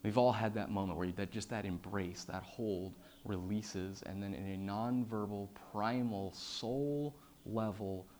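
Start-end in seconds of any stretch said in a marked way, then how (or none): none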